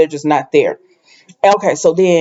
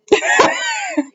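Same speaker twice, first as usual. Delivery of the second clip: laugh; 3 dB quieter than usual. Real, not acted. Laughs before voicing Quinn.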